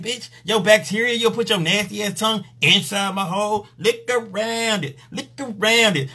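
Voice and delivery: high, commanding partner barking orders; bossy woman voice. Retold or imitated. Partner scolding the man between her legs, high and bossy. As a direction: play her as a picky partner ordering him around.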